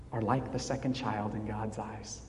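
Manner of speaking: ordinary delivery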